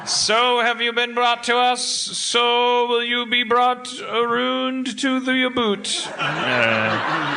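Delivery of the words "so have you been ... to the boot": higher, slow mock ritual chant with Canadian vowels; mock pagan Canadian. Mock Canadian accent chanting a pagan ritual to his cohost. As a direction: intone it like a Canadian pagan rite. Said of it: "add Canadian pronunciation to a pagan ritual".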